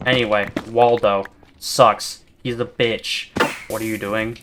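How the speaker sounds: ordinary talk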